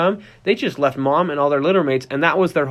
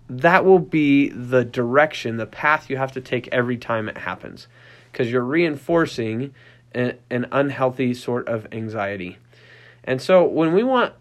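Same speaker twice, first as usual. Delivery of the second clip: ordinary delivery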